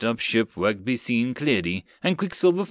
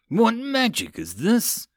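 suspicious; 9 semitones higher, slower than usual. Acted. Suspicious cat girl.

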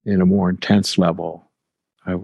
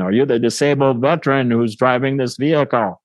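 imitating a gruff man calling out, pitched up; retold insistent question in a higher voice; gruff stranger; 5 semitones higher than usual. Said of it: "that voice again".

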